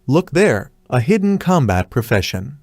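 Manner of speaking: ordinary delivery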